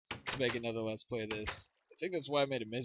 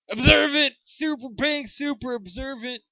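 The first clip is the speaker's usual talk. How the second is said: excited shout; 12 semitones higher, 14 dB louder than usual. Real, not acted. Excited shout while playing.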